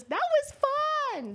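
put-on high, slow delighted kid voice; child voice. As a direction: play the happy little daughter. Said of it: "She goes".

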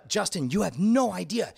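normal talking voice

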